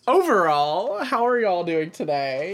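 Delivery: high, loud, drawn-out host question; put-on host voice. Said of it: "let me try that again".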